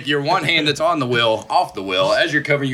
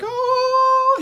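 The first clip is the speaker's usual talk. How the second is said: a sudden squeal, much higher; old boy's squeal. Retold or imitated. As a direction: yelp it way up in a put-on voice. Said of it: "old boy who was like".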